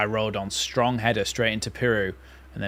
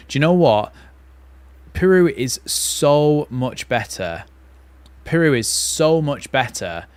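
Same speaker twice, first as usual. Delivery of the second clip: louder and slow, leaning hard on Piroe; emphatic opinion; 4 semitones higher, 7 dB louder, slower than usual. Real, not acted. Fan telling viewers Piroe is better, emphatic. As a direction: insist on it like you're certain.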